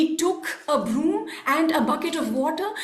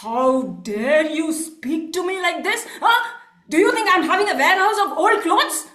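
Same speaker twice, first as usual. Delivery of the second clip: indignant outburst, getting louder and faster; boiling with anger; 3 dB louder, faster than usual. Acted. Merchant rebukes coolie for asking for cloth, furious. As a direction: build from affront to loud fury. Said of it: "Vibhandaka was boiling with anger".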